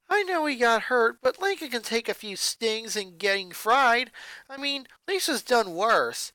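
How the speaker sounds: chatty and cheerful, a bit puzzled; high bubbly joker sister; comedian sister. A narrator's reading.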